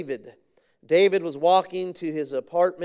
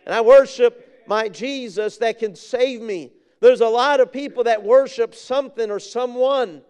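raised, ringing pulpit declaration; fervent preaching; 4 semitones higher, 5 dB louder than usual. Real, not acted. Preacher calling all to worship the Savior, fervent preaching. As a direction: proclaim it with conviction, stretching the last short statements.

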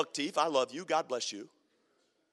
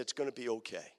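low, soft, reassuring; soft reassurance; 10 semitones lower, 10 dB quieter than usual. Retold or imitated. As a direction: comfort him in a quiet voice. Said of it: "and he said".

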